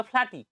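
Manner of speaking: "ফ্ল্যাটি" said high with an F sound for P; Feni-style f for p. Shown as a demonstration. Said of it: "তারা প্লাটিকে কি বলবে".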